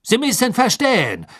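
nervöser Karkaroff, hoch; hastig. Acted. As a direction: gehetzt rechtfertigen. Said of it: "sagte Karkaroff hastig". (Karkaroff redet sich vor dem Gericht hastig heraus)

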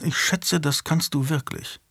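quiet, inward aside; inner thought. A narrator's reading. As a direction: think it to himself, softly. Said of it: "dachte Julian". (leise, nach innen gesprochen)

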